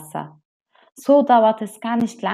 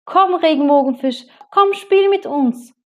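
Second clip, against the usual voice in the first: calling out; 10 semitones higher, 7 dB louder than usual. Acted. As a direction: call him over to play. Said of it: "riefen die anderen".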